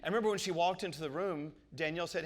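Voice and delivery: ordinary delivery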